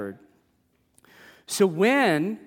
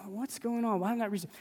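inner worry; 5 semitones higher, 7 dB quieter, much faster than usual. Retold or imitated. Voicing his own inner worry.

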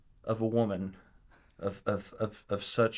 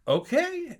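startled okay; 4 dB louder than usual. Retold or imitated. Agreeing, startled by her eagerness.

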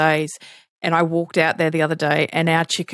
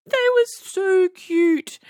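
high, slow cooing over the cute chick; 12 semitones higher, slower than usual. Retold or imitated. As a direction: gush like at a baby animal. Cooing at baby peacock.

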